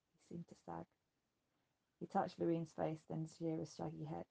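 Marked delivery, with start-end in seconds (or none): none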